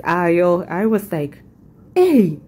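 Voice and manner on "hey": put-on sharp, louder African-accented hey. Shopper reacting to a couple's flattery, African accent. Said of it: "in my African accent, I was like".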